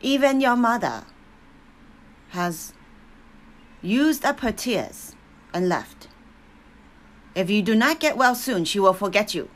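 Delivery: loud, blunt warning; harsh warning. A narrator's reading. Grandmother warns the burned child, loud and blunt. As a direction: jolt her awake with it. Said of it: "Papa said something that was worse".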